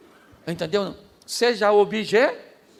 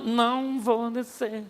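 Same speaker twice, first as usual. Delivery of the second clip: slow, softer, deliberate refusal; slow firm refusal; 3 semitones higher, 3 dB quieter, much slower than usual. Retold or imitated. Nehemiah refusing to come down to his enemies, slow firm refusal. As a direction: draw it out, calm and unmoved. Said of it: "Foi o que Neemias disse".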